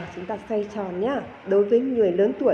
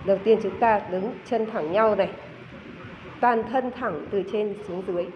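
normal talking voice

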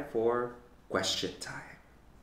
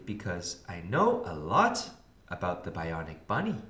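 usual speaking voice

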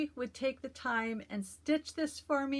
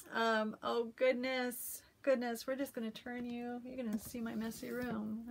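ordinary delivery

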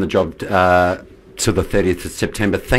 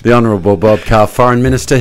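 mock grand title; 4 semitones higher, 7 dB louder, faster than usual. Shown as a demonstration. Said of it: "I haven't had a response from the Honourable".

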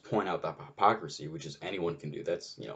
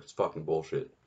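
ordinary delivery

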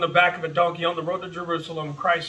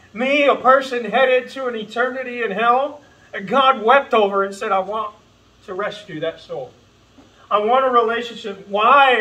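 rising, louder, pleading preacher cry; full-force preaching; 6 semitones higher, 6 dB louder than usual. Real, not acted. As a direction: preach it out to the congregation, building.

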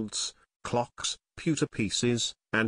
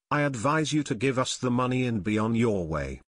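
ordinary delivery, nothing marked

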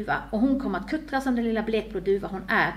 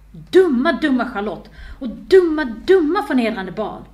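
loud, punched cursing; heated inner tantrum; jealous outburst; 3 semitones higher, 9 dB louder, slower than usual. A narrator's reading. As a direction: spit out each insult with force. Jealous heroine curses her cousin inwardly.

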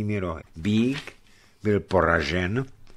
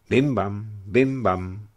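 bell imitation; much slower than usual. Imitating distant alarm bells.

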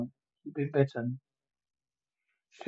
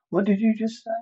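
startled question; 7 semitones higher, 4 dB louder, faster than usual. Acted. Clubber confronts the stranger.

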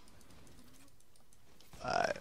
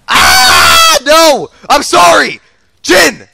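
loud, high frantic cries; scream, then beg at a shout; panicked screaming; 6 semitones higher, 8 dB louder, much slower than usual. Real, not acted. Gamer apologising to Jhin mid-fight, panicked shouting.

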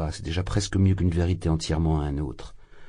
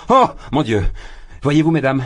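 sudden exclamation; 6 semitones higher, 5 dB louder than usual. Acted. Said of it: "fit-il, s'arrêtant soudain".

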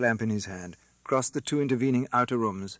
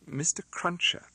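through tears; 7 semitones higher than usual. A narrator's reading. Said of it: "said through her tears".

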